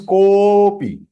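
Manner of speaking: kopi with a very long "ko", held high; four-beat extension. Shown as a demonstration. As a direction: sustain the o of kopi for four beats before "pi". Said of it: "Four times".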